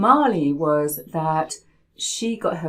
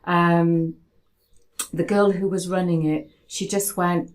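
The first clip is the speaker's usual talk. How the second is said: usual speaking voice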